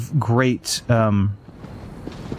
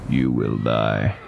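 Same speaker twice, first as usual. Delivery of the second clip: menacing line; 12 semitones lower, much slower than usual. Retold or imitated. Stewie impression in Family Guy bit.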